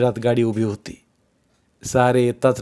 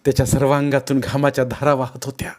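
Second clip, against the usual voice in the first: plain reading voice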